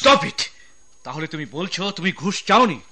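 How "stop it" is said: sharp order. Acted. Boss cuts off arguing officer.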